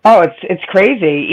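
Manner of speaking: ordinary delivery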